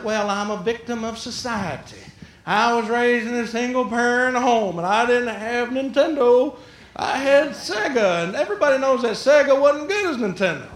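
milk the pity in a put-on whine; self-pitying whine. Someone blaming a hard childhood, mock self-pitying whine.

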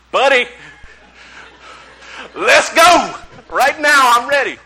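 loud, high-pitched burst of eagerness; over-excited. Retold or imitated. Preacher accepting the invitation to Kingdom Hall, wildly excited. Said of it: "that's how excited I was".

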